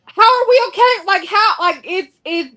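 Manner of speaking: exasperated plea, pitched well up; real disbelief, disturbed. Real, not acted. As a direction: ask it high, truly disturbed. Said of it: "it truly does disturb my soul".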